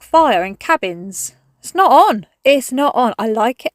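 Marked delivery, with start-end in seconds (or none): 1.6-2.3 higher and louder, firm fall on on; indignant louder protest; emphatic protest. Real, not acted. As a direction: declare it loudly, fed up. Complaining about the weather swap.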